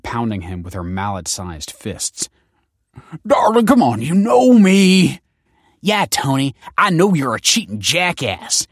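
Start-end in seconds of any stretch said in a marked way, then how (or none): 3.3-5.2 pleading man. Acted. Man pleads under blows. 5.8-8.7 furious woman. Acted. Furious foster mother retorts.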